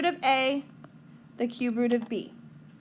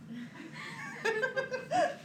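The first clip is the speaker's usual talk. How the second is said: a burst of high laughter; high giggle; 12 semitones higher, 8 dB quieter than usual. Real, not acted. Teacher laughing at a weary student, high giggle.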